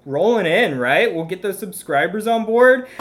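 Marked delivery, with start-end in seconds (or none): none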